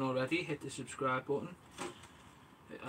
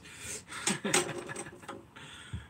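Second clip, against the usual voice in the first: breathy laugh; 5 semitones higher, 5 dB quieter than usual. Real, not acted. Laughing at friend's wife.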